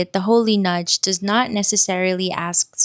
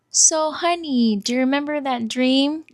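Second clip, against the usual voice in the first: lightly voiced inner nudge, pitched up; gentle inner nudge; 6 semitones higher than usual. Inner nudge asking the speaker about a dream, coaxing. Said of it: "Something like".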